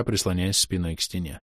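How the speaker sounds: usual speaking voice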